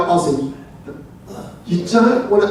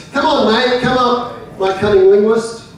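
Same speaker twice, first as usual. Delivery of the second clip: normal talking voice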